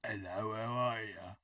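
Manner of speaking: a hammed-up fake Australian hello; badly faked Aussie greeting; terrible Australian accent. Apologising for a terrible Australian accent. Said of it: "that was a terrible Australian accent".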